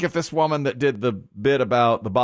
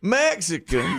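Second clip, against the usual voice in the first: slow, higher mock Southern accent; 5 semitones higher, slower than usual. Another example of words sounding wrong, Southern accent. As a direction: stretch the word in a put-on Southern accent. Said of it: "with a Southern accent".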